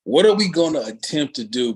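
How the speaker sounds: "attempt" stressed, sceptical. Shown as a demonstration. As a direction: lean hard on "attempt". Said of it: "emphasis on the word attempt".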